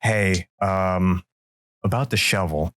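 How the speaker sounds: hesitant, lightly voiced buddy; sheepish buddy. Buddy returning about the shovel, sheepish. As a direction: ease into the apology. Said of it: "And he goes".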